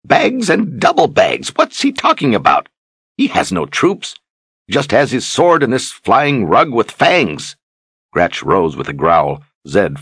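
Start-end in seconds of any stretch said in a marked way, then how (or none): 0.0-7.6 exploding. A narrator's reading. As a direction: explode in exasperation. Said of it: "Zed exploded".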